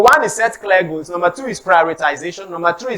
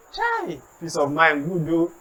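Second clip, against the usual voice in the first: wistful sigh; 4 semitones lower, 9 dB quieter, slower than usual. Retold or imitated. Envious sigh over pictures.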